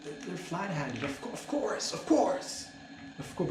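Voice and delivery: dawning realization. Retold or imitated. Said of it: "be like".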